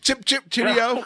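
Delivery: high, slow mock-English chirp; English accent. Host making English visitors comfortable, hammed-up English accent. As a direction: do a cartoonish English greeting. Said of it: "do you try to do an English accent".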